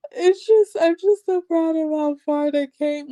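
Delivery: high, mock-weepy voice; imitated crying. Imitating the bride emotional about her parents, mock crying. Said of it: "Why are you crying?".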